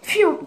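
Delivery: high falling "phew"; quick pass sound effect; imitated throw whoosh. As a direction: whoosh like a ball flying past. Imitating the throw once more.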